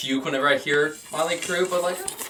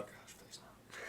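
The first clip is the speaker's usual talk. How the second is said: whisper; 24 dB quieter, slower than usual. Real, not acted. Dreading a deep-dive episode.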